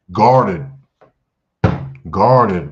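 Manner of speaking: loud, punched single word; emphatic call-out. Acted. Teacher calling out a watchword to the class, loud and emphatic. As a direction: hit it hard like a warning.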